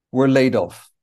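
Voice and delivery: ordinary talk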